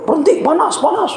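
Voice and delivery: a spirit begging to stop, voice rising; possessed woman. Retold or imitated.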